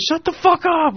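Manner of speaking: high, brash retort; mock yell. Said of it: "like that".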